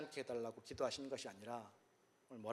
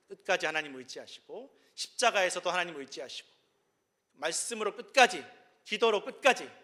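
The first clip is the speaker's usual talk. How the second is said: louder, higher pulpit climax; preacher's rising, louder proclamation; preaching at full force; 4 semitones higher, 11 dB louder than usual. Acted. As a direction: drive it home to the congregation.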